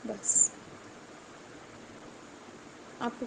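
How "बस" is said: whispered bas. Real, not acted. Tearful testimony closing a plea.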